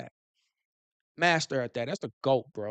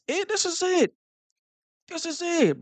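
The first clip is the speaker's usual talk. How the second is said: excited; 12 semitones higher than usual. Real, not acted. Hyped about the season.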